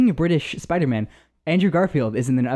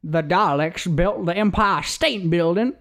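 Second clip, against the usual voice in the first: put-on Southern accent; 4 semitones higher, slower than usual. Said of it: "so they're all like".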